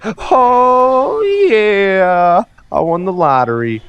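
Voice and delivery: loud, high whoop of triumph, long drawn out; ecstatic lottery winner. Retold or imitated. Lottery winner running up to a stranger, ecstatic yell. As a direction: yell it like you just hit the jackpot.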